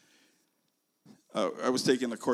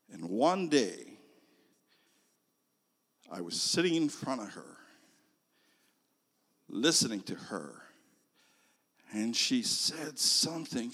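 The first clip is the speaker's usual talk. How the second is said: usual speaking voice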